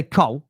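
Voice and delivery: "Cole" the way a Southerner says Kyle; Cole in his accent. Shown as a demonstration. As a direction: say "Cole" with a short dark vowel, almost "Col". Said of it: "it's my accent".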